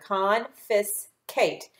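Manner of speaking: very slow "Confiscate" split into its parts; slow syllable by syllable. Shown as a demonstration. Teacher models slow blending for the pupils. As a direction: sound out each syllable slowly. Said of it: "put those three syllables together".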